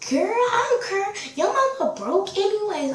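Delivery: high-pitched sassy mimicry; mock sassy insult. Classmate insults her mama, mock sassy.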